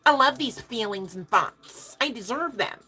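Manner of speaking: a playful begging to the universe; mock eager plea. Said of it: "You're telling the universe".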